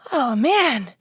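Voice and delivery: usual speaking voice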